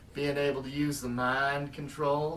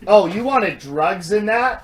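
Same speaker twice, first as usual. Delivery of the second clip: loud; 7 semitones higher, 15 dB louder than usual. Acted. Loud mock surprise.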